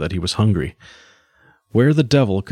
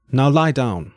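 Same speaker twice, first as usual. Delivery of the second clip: higher, sharper command; firmer repeated order; 5 semitones higher, slower than usual. A narrator's reading. Captor repeats his order to the prisoner, firm and higher. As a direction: lay down the order with no room left.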